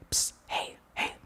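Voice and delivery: hushed whispered call. Retold or imitated. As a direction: whisper to catch his attention. Girl calling him from hiding.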